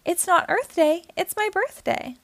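a silly monkey proudly insisting. A narrator's reading. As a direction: insist on your birthday with silly pride. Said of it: "proudly said".

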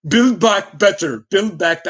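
put-on slow, half-hearted try at a Canadian accent; attempted Canadian accent. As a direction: fumble a Canadian accent, slowly. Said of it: "I can't do a Canadian accent".

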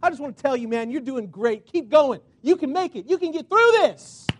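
rising from easy praise to a loud, high cheer; building encouragement. Acted. Pastor voicing a churchgoer cheering him on, building to loud.

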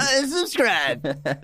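high, very slow rising unsubscribe; drawn-out mock unsubscribe. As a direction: echo it high and stretched. Cohost echoes punchline.

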